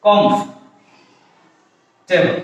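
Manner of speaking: কমফ-, টেবল split by a long pause; correct pronunciation in parts. Shown as a demonstration. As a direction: break it as "komf", pause, "tebol". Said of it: "উচ্চারণটা হচ্ছে কি". (ভেঙে ভেঙে শুদ্ধ উচ্চারণ: কমফ, টেবল)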